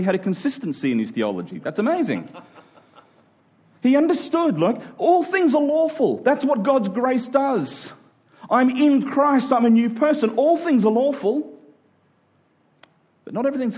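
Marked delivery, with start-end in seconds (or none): none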